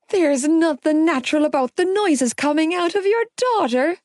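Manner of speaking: high, anguished wail. Acted. Gibbsy wails to the mother about the noises. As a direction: wail it out in distress. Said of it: "Gibbsy wailed".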